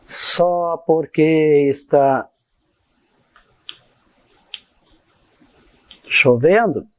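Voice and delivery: slow, drawn-out words with long pauses; stretched syllables, long gaps between phrases; slow dictation. Shown as a demonstration. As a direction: say it piece by piece as you write it out. Dictates slowly while writing it out.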